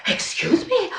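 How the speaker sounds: high, indignant and half-laughing; dramatic disbelief. Retold or imitated. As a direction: play up the offended shock. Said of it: "I'm so, like, overly dramatic".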